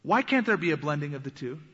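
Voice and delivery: usual speaking voice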